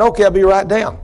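lightly acted holler in reply; retold calling down. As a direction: call back down as the man on the lift. Man calling down from lift.

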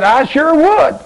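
very high, bright acceptance; eager yes. Retold or imitated. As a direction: leap at the offer. Young man eagerly accepts Jesus.

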